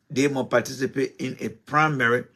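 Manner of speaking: usual speaking voice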